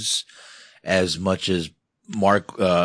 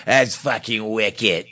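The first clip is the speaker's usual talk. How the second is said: slow, higher Boston accent, imitated; 4 semitones higher, slower than usual. Host showing how Boston people say wicked, Boston accent. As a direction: mimic a Bostonian, stretched out. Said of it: "The way Boston people say".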